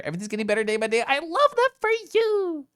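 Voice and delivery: high chirpy voice. Pretend.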